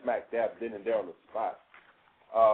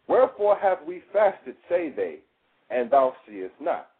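scripture reading; 6 semitones higher, 6 dB louder than usual. Acted. Reading scripture aloud, declaiming.

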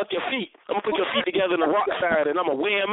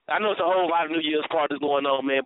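normal talking voice

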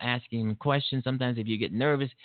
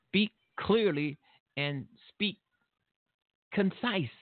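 higher, slow, spelling it out; slow emphatic advice; 8 semitones higher, slower than usual. Retold or imitated. Father lecturing his son on how to speak, slow and firm. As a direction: lay down each word slowly and firmly.

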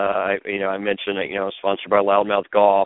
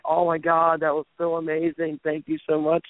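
imitated gushing thanks; 7 semitones higher than usual. Dixie thanking the wrestler by phone, imitated gushing. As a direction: lightly mimic someone thrilled and grateful.

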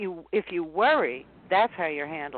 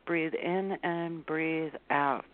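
slow, soft and calm; slow calm breathing cue; 3 semitones lower, 5 dB quieter, much slower than usual. Shown as a demonstration. Coach to anxious listener, slow and soothing. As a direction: guide yourself gently through the breath. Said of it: "That is the first step".

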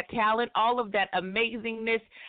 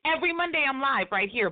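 usual speaking voice

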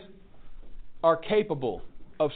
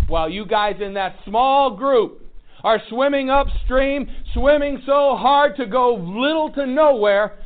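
fiery preaching; 6 semitones higher, 9 dB louder than usual. Acted. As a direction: drive it home to the room. Preaching at full force.

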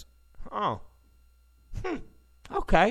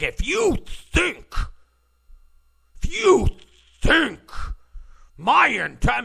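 imitating Comey's indignation; 6 semitones higher than usual. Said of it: "he was like".

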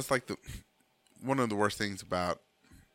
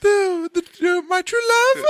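damsel falsetto; 10 dB louder, slower than usual. Pretend.

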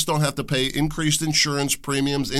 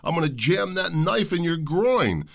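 challenging father; 7 semitones higher than usual. Retold or imitated. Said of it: "be like".

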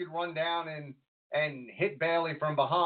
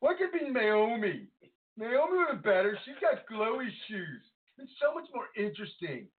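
high, petulant fan complaint; mock whining, pitched way up; whiny internet fan; 8 semitones higher than usual. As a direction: gripe like an outraged online wrestling nerd. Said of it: "That's the I W C voice from now on".

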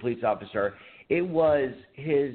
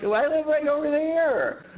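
giggly answer. Retold or imitated. Teen answers cop, laughing.

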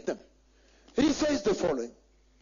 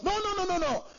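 emphatic denial; 8 semitones higher than usual. Real, not acted. Preacher refutes a claim.

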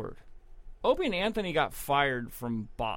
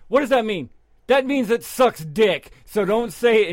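imitating a scolding aunt, higher and louder; aunt losing it; 6 semitones higher, 8 dB louder than usual. Aunt scolding the kids for saying sucks, imitated yelling. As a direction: play the furious aunt scolding small kids. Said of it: "she would lose her shit".

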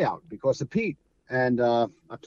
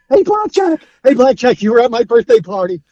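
higher and louder, gushing with starstruck excitement; thrilled adult fans; 9 semitones higher, 9 dB louder than usual. Retold or imitated. Bearded adult fans greet Blackjack, loud and overjoyed. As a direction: imitate grown men gushing like they won the lotto. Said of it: "you think they won lotto".

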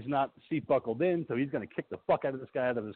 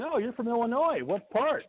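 higher-pitched chatty stranger, lightly acted; lightly voiced roadside guy; 8 semitones higher than usual. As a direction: put on a high, casual inquiring voice. Voices guy leaning into car window.

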